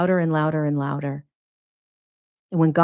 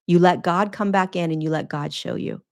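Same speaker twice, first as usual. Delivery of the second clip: ordinary talk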